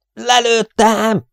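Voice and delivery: high, drawn-out exultant cry; stretched-out yell of triumph; triumphant shout. A narrator's reading. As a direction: shout the kill out slowly and high. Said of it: "ordította Luke".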